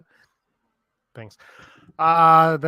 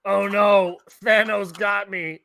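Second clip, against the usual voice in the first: overacted death scene; 6 semitones higher, slower than usual.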